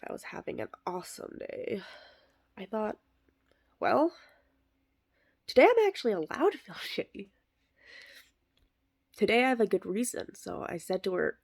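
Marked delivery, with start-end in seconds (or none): 3.8-10.4 high, fast and delighted; laughing into shitty, quick and eager; giddy relief. Acted. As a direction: rush it out with dark glee. Excited inner thought, fast.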